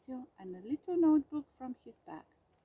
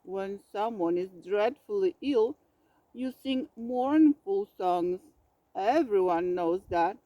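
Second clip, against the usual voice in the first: grumpy growl; 4 semitones lower, 6 dB louder than usual. A narrator's reading. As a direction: lecture him grumpily. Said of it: "growled Bear".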